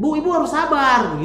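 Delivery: high, loud scolding. Retold or imitated. Doctor scolding an impatient patient loudly. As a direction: snap at her to be patient. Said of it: "Sempat memang aku marahin".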